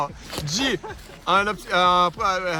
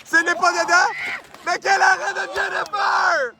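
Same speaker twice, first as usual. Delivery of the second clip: frantic mock sports commentary; 8 semitones higher than usual. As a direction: hype up the big save. Big save called live. (commentaire sportif frénétique)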